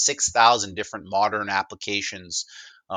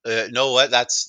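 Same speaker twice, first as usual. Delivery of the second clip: usual speaking voice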